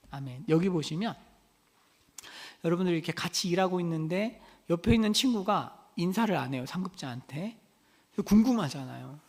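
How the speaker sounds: usual speaking voice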